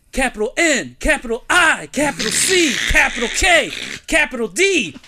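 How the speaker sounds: high, loud shout, letter by letter; defiant shouted spelling. Retold or imitated. Drunk friend spelling his name at a cop, defiant shouting. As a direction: bellow each letter like a belligerent drunk.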